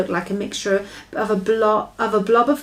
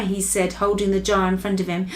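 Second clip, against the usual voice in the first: usual speaking voice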